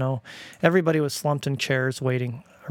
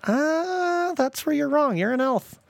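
teasing dad; 13 semitones higher, slower than usual. Retold or imitated. Dad teasing kid in car.

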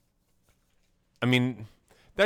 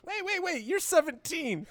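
very high, softer mock alarm; interrupting news anchor; 4 dB quieter than usual. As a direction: cut in as a taken-aback anchor. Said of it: "news anchor kind of interrupted".

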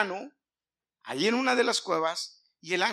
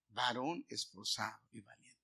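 soft repeat; 8 semitones lower, 10 dB quieter than usual. Retold or imitated. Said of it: "le dice".

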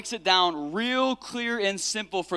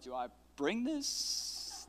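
mock confused doctor; 11 dB quieter, much slower than usual. Doctor disciple confused by call.

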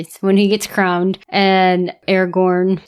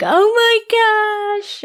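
much higher, slow, drawn-out gush; mock gasp of emotion; 13 semitones higher, slower than usual. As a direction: let it melt out of you, high and slow. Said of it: "and you're like".

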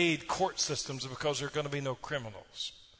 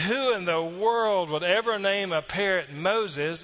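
incredulous thief; 6 semitones higher, 8 dB louder, slower than usual. A narrator's reading. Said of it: "And the thief said".

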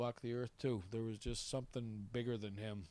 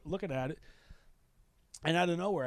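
usual speaking voice

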